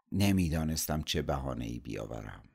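ordinary delivery, nothing marked

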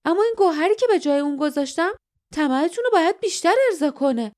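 high-pitched, fast mocking retort. A narrator's reading. Guardian admitting he hid the gem, mocking. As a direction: taunt them, rattling it off. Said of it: "با تمسخر گفت".

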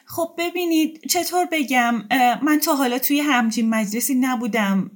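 high, halting, slowed confession; lightly voiced tearful woman; hesitant confession. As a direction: confess shyly, picking words. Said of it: "اختر ... میگه".

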